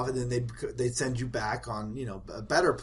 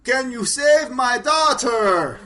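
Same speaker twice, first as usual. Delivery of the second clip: slow, much higher and louder hammed-up plea; movie hero plea; 10 semitones higher, 10 dB louder, slower than usual. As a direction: overact the desperate film line. Villager acts a movie line.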